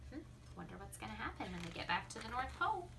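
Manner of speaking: hushed fast wondering; quick aside. Acted. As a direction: rush the wondering to the kids. Reader's aside.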